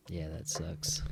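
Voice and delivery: soft, whispered, deflated; whispered gripe. Real, not acted. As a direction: mutter it under your breath. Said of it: "grumbles about forgotten tart".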